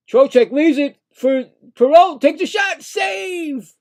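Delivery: high, loud rising call; real excitement on the save; excited play-by-play. Real, not acted.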